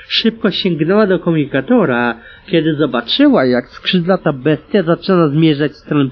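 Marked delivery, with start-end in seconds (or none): none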